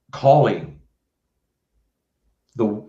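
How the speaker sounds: ordinary talk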